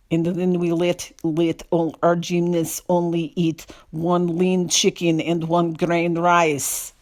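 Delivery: hammed-up Eastern European accent, very slow; mock a thick Eastern European accent, drawn out. Mimicking the audiobook reader quoting gymnastics coaches, exaggerated Eastern European accent. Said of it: "The second thing is she does accents".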